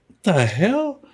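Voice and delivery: high, drawn-out, rising incredulous question; baffled and put out; irritated disbelief. Retold or imitated. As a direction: stretch it out, pitch climbing in disbelief. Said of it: "I kinda got irritated".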